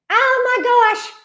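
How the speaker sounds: high, drawn-out oh my gosh; light up at the name; delighted gasp. Real, not acted. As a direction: squeal of delight at a cute name. Reacting to a chat name.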